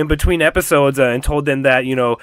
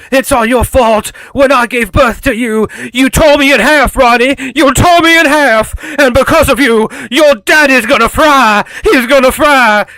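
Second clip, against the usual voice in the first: high, loud, hammed-up accusing voice; accusing monster mother; 9 semitones higher, 6 dB louder than usual. Monstrous mother blaming her daughter, loud high accusing wail. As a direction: wail the blame at your child in a put-on voice. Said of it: "her mother starts telling her".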